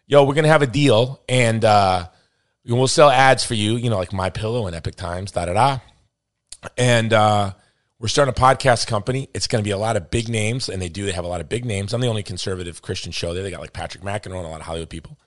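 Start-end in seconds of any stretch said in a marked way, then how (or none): 0.0-10.7 loud, hammed-up Boston accent for a sales pitch; Boston accent imitation, played up and loud; mock Boston accent. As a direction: play a brash Boston media guy, loud. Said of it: "I'm offending everyone in Boston".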